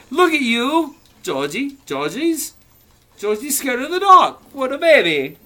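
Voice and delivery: high, loud sing-song taunt; mocking big brother. Big brother taunting scared Georgie, high mocking sing-song. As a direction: tease him like a bratty brother.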